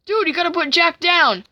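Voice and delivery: high-pitched cartoon protest; urging friend. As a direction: urge him to stop in a high voice. Peanut Butter urges villain to stop.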